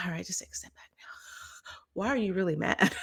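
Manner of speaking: fast whisper to herself; whispered self-talk. Retold or imitated. Telling her partner about stepping back from a fight, whispered. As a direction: hush yourself into stepping back. Quoting inner calming voice.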